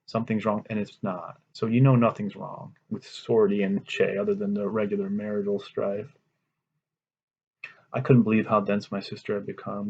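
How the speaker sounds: ordinary talk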